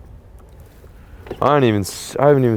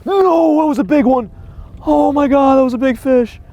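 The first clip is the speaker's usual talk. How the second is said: much higher and louder, wailing over the lost fish; anguished high shout; 7 dB louder, slower than usual. Real, not acted. Angler to himself after losing big fish, anguished high shouting. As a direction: cry out in disbelief as the fish escapes.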